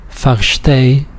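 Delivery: Versteh stressed on its last syllable; final-syllable stress. Shown as a demonstration. Teacher showing an exception to the rule, final-syllable stress. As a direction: land the weight on the end of Versteh. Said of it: "here's the last syllable".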